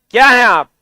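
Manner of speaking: loud, punchy demand for an answer; insistent question. Real, not acted. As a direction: press the class hard for a reply. Question repeated louder after no answer. (ज़ोर से सवाल दोहराते हुए)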